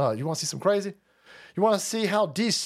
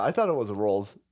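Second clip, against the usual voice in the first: ordinary talk, nothing marked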